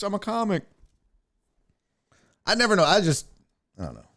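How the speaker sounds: usual speaking voice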